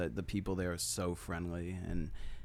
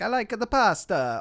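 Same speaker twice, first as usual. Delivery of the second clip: loud, high, hammed-up Italian accent; mock Italian accent; 11 semitones higher, 8 dB louder than usual. Shown as a demonstration. Showing the one allowed accent, mock Italian accent. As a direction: butcher an Italian accent cheerfully. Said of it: "that's the Italian accent".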